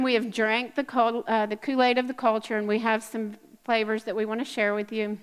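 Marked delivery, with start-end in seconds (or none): none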